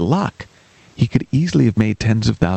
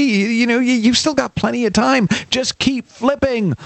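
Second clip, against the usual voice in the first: higher, eager cheerleader tone; higher encouraging voice; 12 semitones higher than usual. Acted. Helper urges the friend to keep flipping, higher and eager. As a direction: brush off his worry in a bright higher voice. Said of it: "you say".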